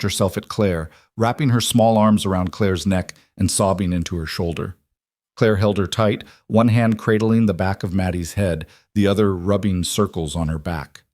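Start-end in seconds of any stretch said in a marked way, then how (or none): none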